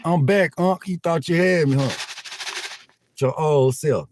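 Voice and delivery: playful mock troll boast; teasing troll. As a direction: gloat like a kid who beat the ban. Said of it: "They'll be like".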